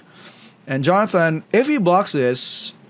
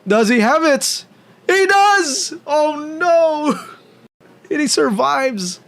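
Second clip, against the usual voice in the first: excited shout; 12 semitones higher, 3 dB louder, slower than usual. Real, not acted. Guard reveal shock.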